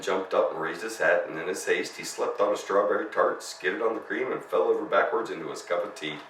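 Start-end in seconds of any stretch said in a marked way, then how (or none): none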